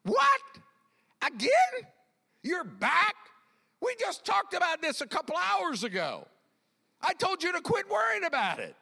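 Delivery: high, loud, incredulous outburst; hammed-up fed-up disbelief; mock frustration. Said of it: "He's never frustrated and going".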